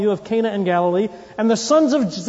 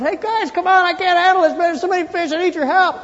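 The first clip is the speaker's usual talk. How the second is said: hollering; 9 semitones higher than usual. Retold or imitated. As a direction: shout for help over to the other boat. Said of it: "he hollers over to Peter".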